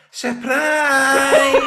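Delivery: high, loud, stretched-out pure surprise; sarcastic cheer at the unwanted surprise; mock surprise. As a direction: ham up the big reveal, laughing. Mocking Facebook live surprise.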